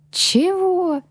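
high startled "what?"; taken aback. A narrator's reading. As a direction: caught off guard, shocked. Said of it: "опешила я".

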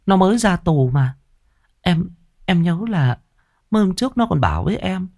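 high, anxious woman's voice; trembling voice. Acted. Accomplice asking her lover about the man they attacked, trembling. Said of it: "hỏi bằng giọng run rẩy".

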